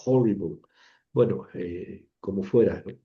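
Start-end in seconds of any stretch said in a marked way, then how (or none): 0.1-0.6 horrible opening on a soft Spanish J; English horrible, its H a gentle J-like breath; soft J sound. Shown as a demonstration. Teacher illustrating the rough breathing, horrible with a soft J sound. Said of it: "se pronuncia como una J suave".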